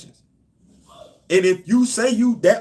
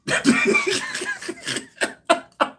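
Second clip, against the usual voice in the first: high-pitched laugh. Real, not acted. Laughs about Batman being safe.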